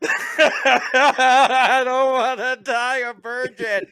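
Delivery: high, slow, laughing wail; high slow laughing plea; laughing fake panic. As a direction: wail in mock terror, laughing. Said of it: "I was like".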